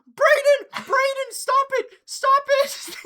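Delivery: very high, very loud shriek; hammed-up frantic mother screeching at her son; screaming mom imitation. Imitating his mom yelling at Braden, high piercing scream. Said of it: "his mom was".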